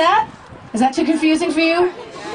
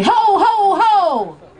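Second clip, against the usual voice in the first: hearty Santa ho ho; 6 semitones higher, much slower than usual. Shown as a demonstration. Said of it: "and you say".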